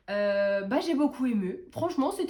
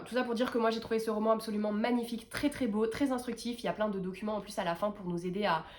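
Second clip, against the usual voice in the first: usual speaking voice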